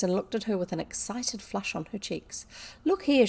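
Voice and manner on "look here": higher, louder eager call; crying out. A narrator's reading. Girl proposing a bargain to her friend, crying out. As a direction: break in with a sudden idea. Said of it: "she cried".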